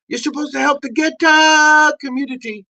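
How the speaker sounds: ordinary talk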